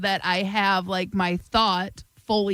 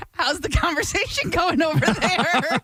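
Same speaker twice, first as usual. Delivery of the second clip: slow, higher teasing voice; teasing husband voice; 7 semitones higher than usual. Retold or imitated. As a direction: tease her like a husband. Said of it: "he'll be like".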